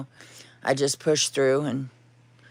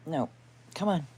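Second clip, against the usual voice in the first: ordinary talk, nothing marked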